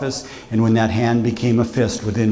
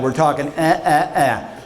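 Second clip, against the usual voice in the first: mock disdain. Mocking his own bad early drafts.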